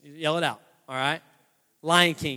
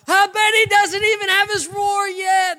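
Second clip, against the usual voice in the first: high, loud hyena jeer; mocking hyena; 10 semitones higher, 8 dB louder than usual. Hyenas jeer at cornered young Simba, mocking hyena voice. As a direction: taunt the cub in a raised, put-on voice. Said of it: "the hyenas start to laugh at him".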